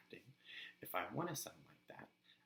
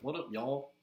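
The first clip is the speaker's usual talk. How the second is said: deep Southern accent; 4 semitones higher, 5 dB louder than usual. Shown as a demonstration. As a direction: lay on a heavy Southern accent. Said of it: "real deep Southern accent".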